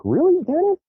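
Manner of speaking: high, incredulous question; mock disbelief. Teasing the calm friend Dennis, high mock disbelief. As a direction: act amazed at your calm friend. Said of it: "I was like".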